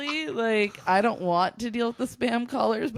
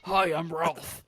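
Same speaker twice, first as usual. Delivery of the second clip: Ralph voice; 3 semitones lower, slower than usual. Pretend. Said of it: "What would your voice be?".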